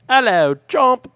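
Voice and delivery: high, loud cartoon voice; mock greeting. Cohost imagines someone greeting a freed prisoner. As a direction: yell it in a squeaky put-on voice. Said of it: "somebody will say".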